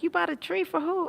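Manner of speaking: mock-surprised high voice; high imitation of stepmother. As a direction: play her surprised, high and light. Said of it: "She was like".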